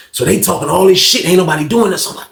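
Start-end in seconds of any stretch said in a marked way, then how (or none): none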